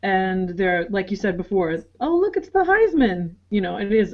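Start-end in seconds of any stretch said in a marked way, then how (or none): 2.0-3.3 higher-pitched mock awe of a gawking tourist; starstruck fan. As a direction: imitate an excited sightseer, pitched well up. Jury gawking on a fan tour.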